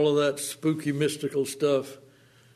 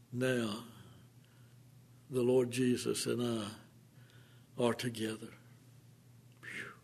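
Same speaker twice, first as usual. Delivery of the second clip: hushed; 2 semitones lower, 9 dB quieter than usual. Acted. Hushed intimate climax of sermon.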